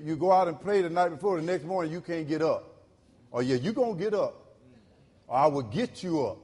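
ordinary talk

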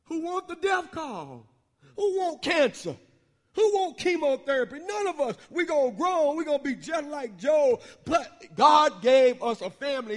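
rising, loud preacher cadence; preaching at full force. Real, not acted. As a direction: drive it home to the congregation. Preaching builds to shout.

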